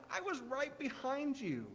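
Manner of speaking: high, soft, slow imitation of a child; giggly five-year-old. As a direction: lightly voice a gleeful kid, drawn out. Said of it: "He was giggling and laughing".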